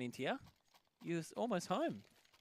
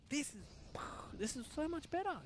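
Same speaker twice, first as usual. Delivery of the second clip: excited; 13 semitones higher, 4 dB quieter than usual. Real, not acted. Excited about a better play.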